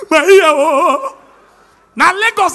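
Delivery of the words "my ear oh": high, loud mock wail; pained cry. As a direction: cry out as if just slapped. Crying out after slap.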